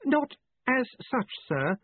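high, carefully patient reply; politely restrained assistant; well-honed patience. A narrator's reading. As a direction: correct the boss with practised patience. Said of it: "said Ponder with well-honed patience".

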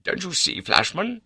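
high, urgent appeal; excited. A narrator's reading. Baronet taking Flashman by the arm, excited. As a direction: press him eagerly to understand. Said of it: "In his excitement".

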